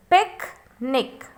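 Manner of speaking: "picnic" broken as "pic... nic"; split into syllables. Shown as a demonstration. Teacher showing there are two syllables, "picnic" split as "pic... nic". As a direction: say "picnic" slowly, pausing between "pic" and "nic". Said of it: "when we will pronounce it".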